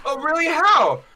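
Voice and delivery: high, loud surprised aunt; aunt hearing the ex knows June, mock amazed; mock surprise. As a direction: play the aunt's shocked question. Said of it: "And my aunt goes".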